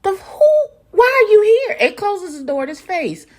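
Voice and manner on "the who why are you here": high, loud outraged disbelief; shocked Brandon. Retold or imitated. Brandon seeing Carl at his door, high outraged squawk. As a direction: squawk like a stunned host. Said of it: "He's like".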